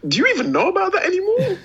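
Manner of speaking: high-pitched, quick disbelieving question; incredulous. Retold or imitated. Podcaster questioning a teenager's old-fashioned references, incredulous. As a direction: ask it high and fast, baffled.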